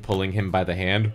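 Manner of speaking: usual speaking voice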